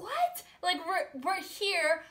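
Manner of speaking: shocked "what". Retold or imitated. Friends refused entry to the house, a high shocked what. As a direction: jump way up in pitch, stunned. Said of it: "And we're like".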